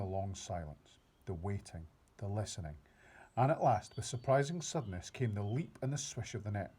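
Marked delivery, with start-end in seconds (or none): none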